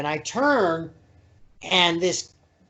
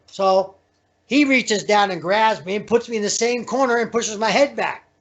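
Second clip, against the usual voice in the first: ordinary talk, nothing marked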